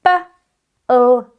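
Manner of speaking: B-O-O sounded out slowly, letter by letter; letter-by-letter sounding. Shown as a demonstration. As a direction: spell out each sound clearly for children. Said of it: "My turn first".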